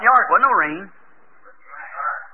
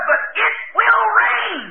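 much higher, very slow and drawn out; slow insistence; 12 semitones higher, slower than usual. Retold or imitated. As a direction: stretch every word with firm faith. Noah insists again.

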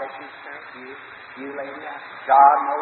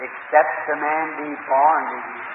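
ordinary delivery

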